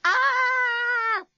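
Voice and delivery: piercing high scream of terror; Lucy shrieks at the sight. A narrator's reading. Terrified girl screams as the thing emerges. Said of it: "screamed Lucy".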